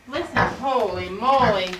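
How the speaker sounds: ordinary talk, nothing marked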